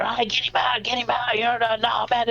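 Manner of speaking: high-pitched dad hollering without real volume; sideline dad. Retold or imitated. Dad videotaping his kid's game, mock sideline cheering. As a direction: imitate an excited dad urging players on. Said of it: "I'm being the dad".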